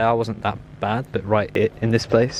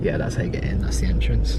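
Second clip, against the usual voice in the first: whispering. Real, not acted. Pointing out entrance in dark.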